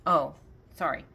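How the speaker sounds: ordinary delivery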